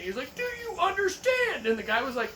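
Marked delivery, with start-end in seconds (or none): 0.3-1.7 much higher, slow demanding sergeant; stern drill sergeant. Retold or imitated. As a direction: drag it out, pressing hard. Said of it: "He kept repeating".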